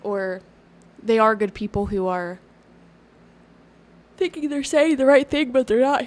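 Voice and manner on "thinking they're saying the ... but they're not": words stretched by a yawn. Real, not acted. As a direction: talk through a big yawn. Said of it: "Here I am yawning again".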